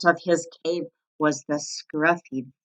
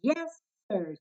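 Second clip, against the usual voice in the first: meek bunny; 5 semitones higher, 9 dB quieter than usual. A narrator's reading. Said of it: "said Buddy".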